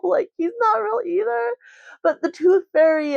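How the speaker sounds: high, laughing confession; high laughing reply breaking the news to her son; giggly admission. Retold or imitated. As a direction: let it slip out through a giggle. Confirming Santa isn't real.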